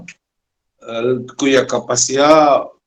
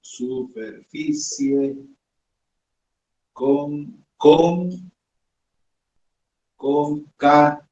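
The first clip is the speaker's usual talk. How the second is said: dictating slowly; 2 semitones higher, slower than usual. Shown as a demonstration. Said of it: "la superficie concateneada".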